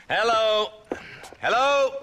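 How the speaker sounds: calling out on a brand new telephone; loud calling. Acted. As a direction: shout into the receiver like you don't trust it.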